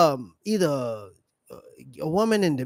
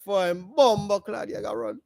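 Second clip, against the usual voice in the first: mock Jamaican accent; 5 dB louder than usual. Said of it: "hear him talking Jamaican man with the accent".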